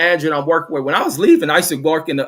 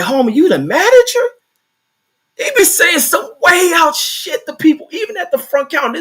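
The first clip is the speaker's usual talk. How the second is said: much higher, louder mock voice of the coworker; 13 semitones higher, 4 dB louder than usual. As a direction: send up the coworker at the counter.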